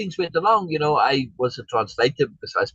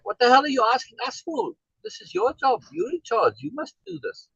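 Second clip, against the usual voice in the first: put-on higher-pitched, irritated scolding of a fierce young woman; scolding annoyed woman; 11 semitones higher than usual. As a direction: scold him like he's failed his job, voice raised in pitch. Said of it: "And almost scolds me".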